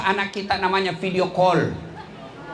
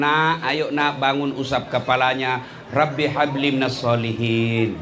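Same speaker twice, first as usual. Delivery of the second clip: tender waking; 9 semitones lower than usual. Retold or imitated. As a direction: gently wake a sleeping child. Waking his daughter tenderly.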